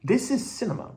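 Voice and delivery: sarcastic, self-satisfied "this is cinema"; a hammed-up proud director admiring his own film; mock smug praise. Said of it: "they were looking at this and being like".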